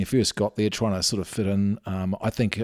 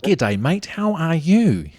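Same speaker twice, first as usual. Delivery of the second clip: hammed-up Australian greeting, slow and drawn out, much higher on How are you; thick Aussie accent; 7 semitones higher, 4 dB louder, much slower than usual. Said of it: "you gotta lay it on thick sometimes".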